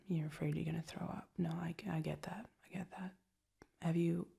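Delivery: soft, caring whisper for a migraine. Real, not acted. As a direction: whisper gently to a sick partner. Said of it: "that's soft voices".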